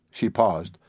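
ordinary delivery

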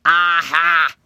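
loud, low alligator "aha"; villain's cry. A narrator's reading. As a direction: pounce on it like a storybook villain. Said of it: "he cried".

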